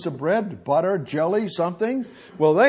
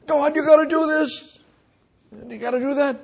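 mock bossy demand; 9 semitones higher, faster than usual. Man bossing God around.

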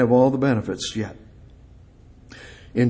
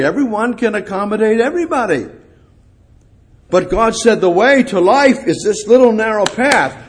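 loud, high, ringing preaching; fiery sermon delivery; preaching at full force; 9 semitones higher, 5 dB louder than usual. Acted. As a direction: proclaim it to the whole congregation.

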